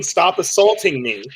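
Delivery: plain reading voice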